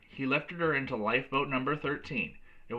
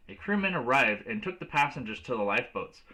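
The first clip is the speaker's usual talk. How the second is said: ordinary delivery, nothing marked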